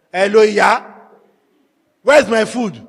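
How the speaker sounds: loud, brusque demand. Shown as a demonstration. Husband demanding his food from wife, rude demanding tone. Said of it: "It's different from".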